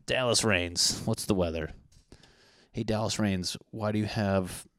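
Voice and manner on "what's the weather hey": ordinary talk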